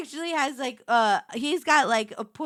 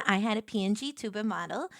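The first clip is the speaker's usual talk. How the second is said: normal talking voice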